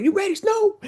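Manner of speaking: high, playful pregame call; imitating Cynthia. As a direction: pitch it way up like a hype teammate. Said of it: "she would come up before every game and go".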